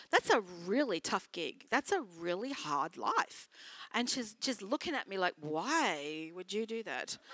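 low, soft imitation of doubt; hushed, incredulous quote; retold disbelief. Retold woman doubting a pastor's life.

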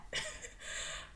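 soft airless giggle at herself; a quiet unvoiced giggle; breathless giggle. Real, not acted. Host laughing at forgetting her point, breathless giggle.

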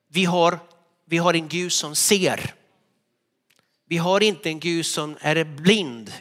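ordinary talk, nothing marked

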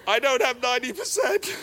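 half-laughing at his own lie. Retold or imitated. Pastor confessing his lie to God, through laughter.

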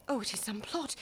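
whispered, hushed suspicion; suspicious whisper. Acted. Wife whispering her fear of a plot.

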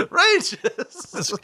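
high, loud cry of the name; mock announcer shout. Co-host hamming up a dead host's name, loud shout. Calling the name out for comic effect.

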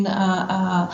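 ordinary talk, nothing marked

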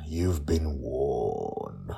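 slow, low mock-ominous line; spooky warning voice. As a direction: drag it out darkly.

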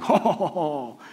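mock chortle among cronies; put-on "ho, ho" laugh; smug buddies laughing. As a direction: imitate a self-satisfied group chuckle. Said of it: "imagine him and his buddies like".

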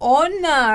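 "honor" said with no H, starting on the vowel; silent H. Shown as a demonstration. Teacher models a word for Thai learners, "honor" with silent H. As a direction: say "honor" as "onner", H left silent. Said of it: "you don't pronounce the H".

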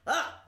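sudden loud "ah!"; frustrated cry. Real, not acted. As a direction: burst out in exasperation. Exasperated at having to ignore him.

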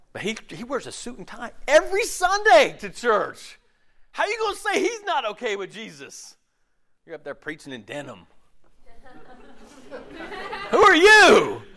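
high, outraged with a laugh; scandalised congregant. Retold or imitated. Churchgoer scolding the denim-wearing preacher, high outraged. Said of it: "offended congregant scolding preacher".

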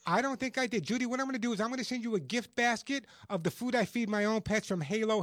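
normal talking voice

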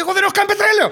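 high, loud imitated complaint; mock angry outburst. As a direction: throw the neighbour's protest out loud and high. Neighbour's angry outburst retold. (μιμείται θυμωμένο γείτονα, ψηλά και δυνατά)

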